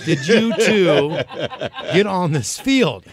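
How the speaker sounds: slow, stretched, laughing; laughing disbelief. Retold or imitated. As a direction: drag it out through a laugh. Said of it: "he goes".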